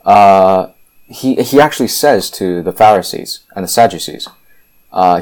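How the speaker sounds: usual speaking voice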